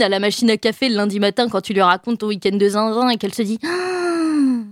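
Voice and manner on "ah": mock shocked gasp. Coworker's shocked reaction.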